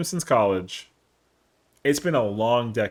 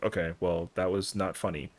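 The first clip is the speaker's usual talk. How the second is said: lower, softer deadpan complaint; flat unamused; 6 semitones lower, 8 dB quieter than usual. Retold or imitated. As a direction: sulk it, low and dry. After her fake I'm done.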